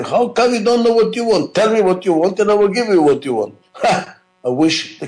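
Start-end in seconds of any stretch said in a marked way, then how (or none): 0.0-3.5 mock exasperated demand to the client, pitched up; upset demand. Shown as a demonstration. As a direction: play the impatient manager losing patience. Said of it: "Don't get upset and say".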